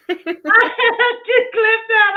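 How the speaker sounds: high, slow words through real laughter; cracking up while she speaks; laughing. Real, not acted. To her co-host about the clip, laughing hard.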